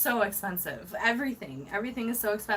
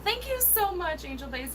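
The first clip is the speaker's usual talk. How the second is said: very high, gushing thanks; delighted gratitude; 8 semitones higher than usual. Real, not acted. Streamer thanking another super chat sender, delighted. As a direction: squeal your thanks with delight.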